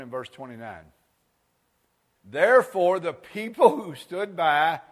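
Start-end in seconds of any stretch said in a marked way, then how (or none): 2.2-4.8 slow, loud reading voice; scripture reading. Acted. Preacher reading verse twenty-nine, slow and loud. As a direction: read it out slow and strong.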